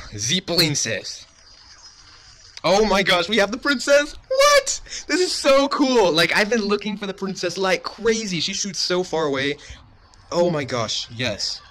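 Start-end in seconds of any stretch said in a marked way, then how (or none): 2.6-6.1 much higher, louder thrilled yelling; excited shout. Real, not acted. First play with new card.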